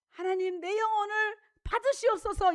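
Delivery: 「받으시옵소서」を高く強く、祈るように張り上げる; 声を高めて死に際の祈りを手本として見せる; fervent prayer. Shown as a demonstration. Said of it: "이렇게 하고 죽어야 돼".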